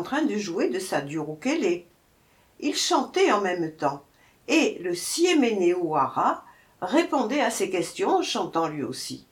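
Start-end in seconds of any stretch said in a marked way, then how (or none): none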